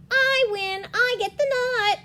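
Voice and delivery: high, loud gleeful boast; delighted. Acted. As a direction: crow with delight over winning.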